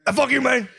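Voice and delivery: 高い声で真似る. Retold or imitated. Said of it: "He just go".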